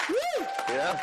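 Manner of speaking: very high whoop of triumph; cheering. Real, not acted. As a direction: whoop like you just won. Cheering after finishing story.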